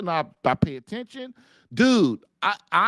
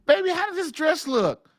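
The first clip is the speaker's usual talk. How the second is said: high, coy wife voice asking about her dress; imitating wife; 10 semitones higher than usual. Wife asking husband about her dress, high mock coy voice. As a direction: mimic a wife fishing for a compliment. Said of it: "your wife stands in front of you and goes".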